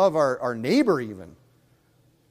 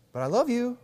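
mock pleading husband; 9 semitones higher than usual.

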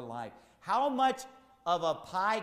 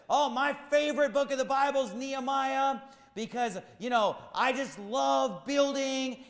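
lightly voiced gushing pastor, higher and a little louder; mocking the pastor; 9 semitones higher, 5 dB louder than usual. Preacher mocks a building-crazy pastor with a light gushing voice. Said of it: "this pastor said".

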